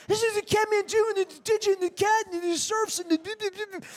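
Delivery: very high monotone gabble; mock breathless fangirl jabber, squeaky high; excited babbling wife. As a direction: imitate a starstruck wife babbling on one high note. Imitates wife's excited babbling explanation.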